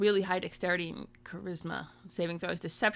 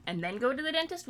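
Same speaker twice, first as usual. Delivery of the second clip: normal talking voice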